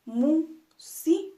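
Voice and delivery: "música" slowly split mú-si-ca with pauses; syllable by syllable. Shown as a demonstration. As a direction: break "música" into three separate beats. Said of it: "las vamos a dividir en sus partes". ("música" lento y separado, mú-si-ca)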